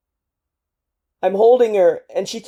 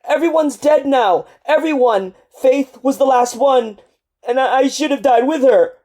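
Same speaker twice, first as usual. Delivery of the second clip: desperate sobbing; 6 semitones higher, 6 dB louder, faster than usual. Acted. Soldier breaking down in grief.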